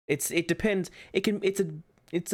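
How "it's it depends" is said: ordinary talk, nothing marked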